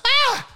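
a sudden high yelp of fright; startled shriek. Acted. Nurse finds the empty bed and gives a sudden high shriek.

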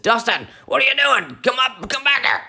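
high-pitched, urgent call to a sleepwalker; high, panicky retelling; alarmed call. Retold or imitated. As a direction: mimic yourself frantically calling someone back. Acts out calling sleepwalker back.

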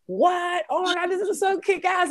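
high, loud amazed cry; excited outburst. Retold or imitated. Young fan discovering Linkin Park, amazed high cry. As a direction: squeal in teen amazement. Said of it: "and it was like".

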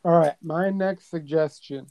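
ordinary talk, nothing marked

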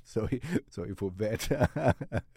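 slow, laughing through the words; laughing it off, real embarrassment; laughing apology. Real, not acted. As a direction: giggle out a sheepish sorry. Laughs at tech mishap.

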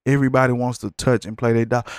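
usual speaking voice

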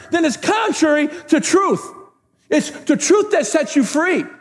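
high, ringing preacher's cry; full-force preaching. Real, not acted. Pastor challenging the church to fight for faith, full-force preaching. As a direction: drive it home to the whole church.

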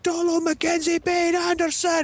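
slow, piercingly high mock screech; banshee mom. Mom calling her kid through the woods, banshee shriek. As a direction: stretch the full name out like a banshee. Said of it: "like a frickin' banshee".

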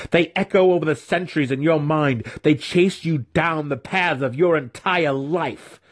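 high, intense, haunting goad; taunting. A narrator's reading. Mentor tormenting his student with guilt, taunting. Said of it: "Zack taunted".

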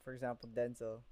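ordinary delivery

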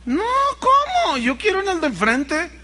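high, outraged mock diva; mocking a pampered singer, high and indignant. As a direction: squeal it like a spoiled star. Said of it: "y dijo".

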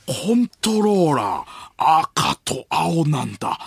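slow, stretched feigned wonder; 伊集院光. Acted. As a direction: puzzle it out aloud very slowly. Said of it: "伊集院光さんが". (ゆっくり感心する伊集院のものまね)

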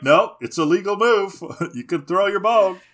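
hammed-up referee dismissing a complaint; mock official ruling. Acted ruling that move is legal.

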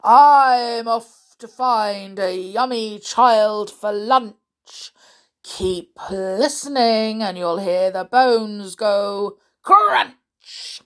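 slow, loud, menacing relish; greedy beast, drawing words out; gloating crocodile. Acted. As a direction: relish it, exploding on the last word. Crocodile figure in story.

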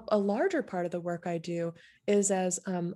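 normal talking voice

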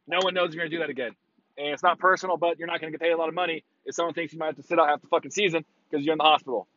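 ordinary delivery

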